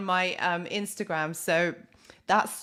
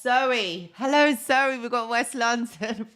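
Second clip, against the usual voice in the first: loud, higher-pitched delighted greeting; excited shout-out; 5 semitones higher, 6 dB louder, slower than usual. Real, not acted. Host greets live viewers from West London, excited shout-out. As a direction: hail a friend with a burst of excitement.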